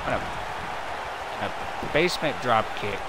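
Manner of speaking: brisk, running wrestling call; play-by-play commentary. Acted. Commentator calling the match for viewers, brisk play-by-play. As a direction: keep the action rolling like a ringside caller.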